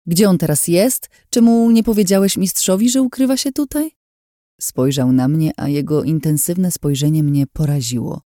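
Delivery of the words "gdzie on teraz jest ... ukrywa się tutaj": urgent, high-pitched demands for answers; agitated questioning. A narrator's reading. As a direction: fire off insistent questions, voice raised high. (wzburzone, natarczywe pytania)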